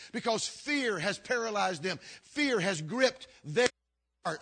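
loud, driving preacher cadence; preaching at full force. Acted. Pastor preaching to his church, loud and insistent. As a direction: hammer each "they stop" line home to the congregation.

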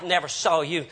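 usual speaking voice

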